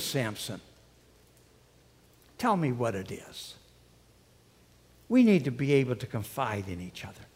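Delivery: soft, low, coaxing plea; pleading. Acted. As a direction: wear him down gently with a soft plea. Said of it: "She wears him down".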